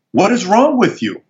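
high-pitched, incredulous comeback; retold flip-out. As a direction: imitate a dad aghast at his son. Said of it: "He flips out".

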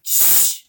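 unvoiced hushing sound; urgent shush. Hushing Jasper from behind Dag, soft shush. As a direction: shush him softly.

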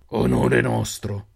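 very slow, deliberate leader's voice; slow bandit leader. A narrator's reading. Bandit chief welcoming strangers, slow and deliberate. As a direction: drawl it like a predator sizing them up.

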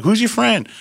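high, loud curious question; eager question. Retold or imitated. Studio owner asking Dan about George. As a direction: ask eagerly about the stranger.

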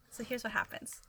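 hushed, conspiratorial; whispering. Real, not acted. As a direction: lean in and whisper it. Conspiratorial setup.

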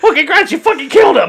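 high laughing yell at her; laughing mock shout. Mocking Courtney, laughing shout.

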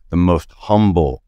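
humble drawn out slowly with a falling tune; slow, weighty emphasis on humble; stress on humble. Real, not acted. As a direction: lean on the word humble, slow and heavy. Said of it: "I can't stress that word".

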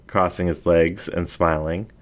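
usual speaking voice

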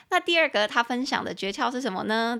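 ordinary talk, nothing marked

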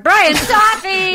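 slow, high, loud game-show announce of a name; announcer intro. Acted. Host presenting her co-host to listeners, announcer style. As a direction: stretch it out like a ring announcer.

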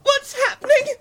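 panicked. Acted. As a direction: demand answers in panic. Mother panicking.

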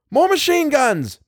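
high, carrying yell. A narrator's reading. Bill yells to Tom in battle, a high yell. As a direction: yell it across the trench, pitch leaping up. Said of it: "Bill yelled to Tom".

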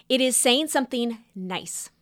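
normal talking voice

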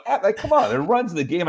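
high, exasperated mock protest; retold frustration. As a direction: complain at the screen, half-acted. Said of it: "I'm getting so angry".

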